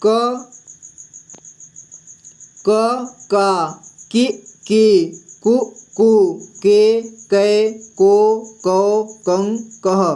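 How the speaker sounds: क का कि की कु कू के कै को कौ कं कः recited slowly; teacher reciting the क series for pupils; reciting the barakhadi. Shown as a demonstration. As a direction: chant each vowel sign on क, drawn out. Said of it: "अब पढ़ते हैं हम".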